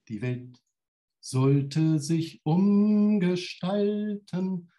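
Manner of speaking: lyric spoken slowly, drawn out at the end; recited lyrics. Acted. Poet reciting a [name] lyric to the audience. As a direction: savour the lyric line by line.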